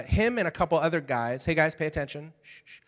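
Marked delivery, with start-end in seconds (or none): 2.4-2.8 soft hushing to the room; quiet the crowd down; shushing. Real, not acted. Quieting the distracted audience.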